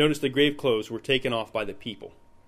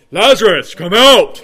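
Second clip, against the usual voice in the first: much louder, higher booming call; 7 semitones higher, 17 dB louder than usual. Acted. Teacher showing how the reader should have said it, loud voice. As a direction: shout it out in a big voice. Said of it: "He said a loud voice".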